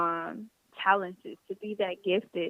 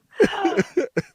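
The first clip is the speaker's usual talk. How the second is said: hiccup gasp; 8 semitones higher, 7 dB louder than usual. Real, not acted. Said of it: "Shell on the podcast with the hiccups".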